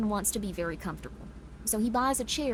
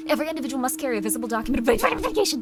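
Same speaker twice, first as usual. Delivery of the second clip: fast reading; 3 semitones higher, 6 dB louder, faster than usual. Acted. Fast reading of eerie rules.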